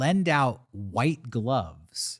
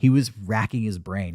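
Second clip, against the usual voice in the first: ordinary delivery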